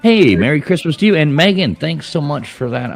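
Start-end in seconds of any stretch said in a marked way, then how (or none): none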